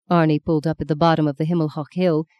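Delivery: plain reading voice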